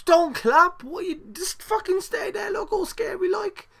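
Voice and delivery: very high-pitched outraged scolding; comically high, indignant rant; exasperated squawking. Acted. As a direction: squeal your disbelief at the clapping wrestler. Mock scolding of a wrestler.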